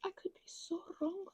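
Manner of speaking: ordinary talk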